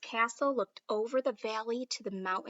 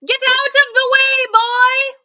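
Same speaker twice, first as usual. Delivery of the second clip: much higher, louder royal order; commanding shout; 13 semitones higher, 17 dB louder than usual. Acted. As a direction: order the boy off the field. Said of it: "ordered the king".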